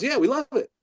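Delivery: high-pitched, eager confirmation; acted enthusiasm. Attorney confirms the band's approval to the author, high and eager. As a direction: confirm good news with high-pitched delight.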